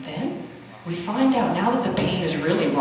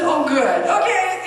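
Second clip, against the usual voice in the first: relieved delight; 7 semitones higher, 6 dB louder, slower than usual. Retold or imitated. Son no longer feels like a shrimp.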